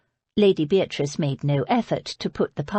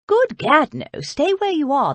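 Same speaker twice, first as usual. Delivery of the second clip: put-on high, louder startled old lady; exasperated Lady Beatrice; 9 semitones higher, 6 dB louder than usual. A narrator's reading. Lady Beatrice to her niece, a high, startled exclamation. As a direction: blurt it out in aristocratic alarm.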